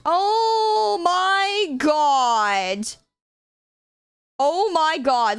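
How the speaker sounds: stunned by the rare find, gasping it out; amazed gasp. Real, not acted. Finds rare old event Celebi.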